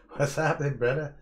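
high, loud, hyped hello; excited loud greeting. Real, not acted. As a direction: shout a hype welcome to a mate. Calling out greeting to a viewer.

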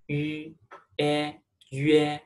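yue built from yu and e; pinyin yue demo. Shown as a demonstration. Teacher shows students how yue is said. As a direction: blend yu into e: yue. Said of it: "It's pronounced yue".

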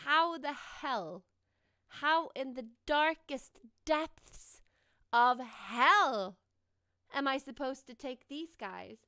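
slow, low, weighty delivery; exasperated. Real, not acted. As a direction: draw out each word in exasperation. Exasperated question about the game.

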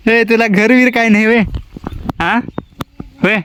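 high, playful pet talk; teasing the pet. Real, not acted. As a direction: tease the little animal fondly.

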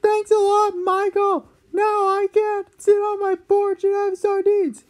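high squeaky grumpy complaint; sarcastic cranky dad. Acted. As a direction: whine it sarcastically. Dad sulking.

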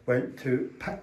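ordinary delivery

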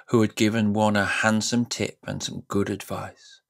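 ordinary delivery, nothing marked

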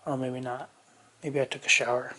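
usual speaking voice

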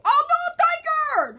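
very high, loud outcry; alarmed shout. Real, not acted. Player reacting to Tiger's attack, high alarmed shout. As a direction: call out in high dismay.

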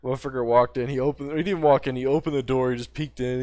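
ordinary talk

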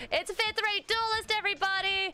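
high, ringing announcement to the crowd; excited announcement. Real, not acted. Host announcing a Bandit Keith cosplay to chat, high and excited. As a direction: present her proudly to chat.

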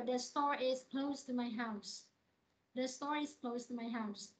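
slow statement falling at house; falling tune. Shown as a demonstration. Teacher models a falling statement slowly. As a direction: let The store is close to my house drop at the end. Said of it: "Ví dụ".

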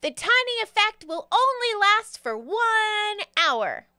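high, emphatic pixie voice; pixie giving warning. Acted. Pixie explains the potion to a newcomer, high and emphatic. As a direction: stretch key words in a high character voice.

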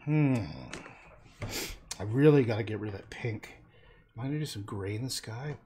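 usual speaking voice

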